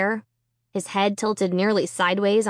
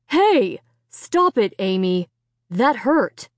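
annoyed; slower than usual. Acted. Father scolds daughter.

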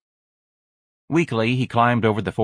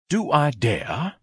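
slow, higher-pitched musing question; self-questioning; 5 semitones higher, much slower than usual. A narrator's reading. Ignatius ponders an affair, slowly asking himself. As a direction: draw it out, wondering to oneself. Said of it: "Ignatius asked himself".

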